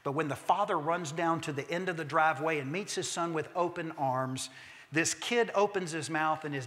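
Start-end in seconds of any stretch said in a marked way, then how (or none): none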